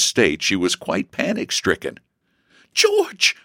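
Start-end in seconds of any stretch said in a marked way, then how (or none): 2.7-3.4 high-pitched gasp of alarm. A narrator's reading. Sister overwhelmed by brother's embraces, gasping. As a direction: cry the name in mock panic. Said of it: "she gasped".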